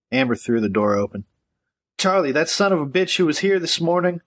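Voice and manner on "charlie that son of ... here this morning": high, frantic woman's voice. Acted. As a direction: blurt it out in a panic. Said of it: "Her voice trailed off".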